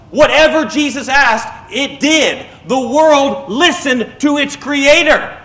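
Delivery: high, ringing pulpit proclamation; impassioned sermon climax, pitched well up; full-force preaching. Real, not acted. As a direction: proclaim it with rising fervour to the congregation.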